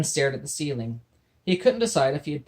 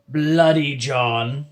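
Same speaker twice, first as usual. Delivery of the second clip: very slow, louder pirate growl; pirate correcting; 8 dB louder, much slower than usual. Acted. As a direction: drag out the name like an offended pirate. Pirate correcting his name.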